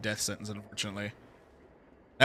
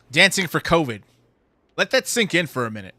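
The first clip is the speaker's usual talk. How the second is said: louder, higher outraged emphasis; indignant rant; 5 semitones higher, 9 dB louder than usual. Real, not acted. Ranting streamer to viewers, loud and indignant. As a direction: drive the outrage home, loud and raised. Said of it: "I'm getting really worked up".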